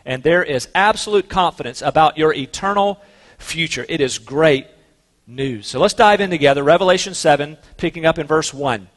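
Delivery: ordinary talk, nothing marked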